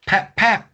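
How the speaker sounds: sharp high pap pap, punched; high window smash sound. Retold or imitated. Imitating the tool breaking the window, sharp pap pap. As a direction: mimic a tool smashing glass.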